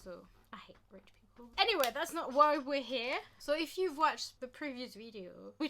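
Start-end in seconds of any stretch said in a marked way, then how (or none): 0.0-1.5 quick breathy whisper under the breath; whispered aside. Real, not acted. Vlogger whispering a cheeky aside about rich people. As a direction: slip it in as a secret. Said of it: "unvoiced whisper aside".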